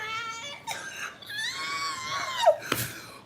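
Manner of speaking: long high falling wail; hammed-up despairing wail; mock despairing wail; wailing cry. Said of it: "I'm just like".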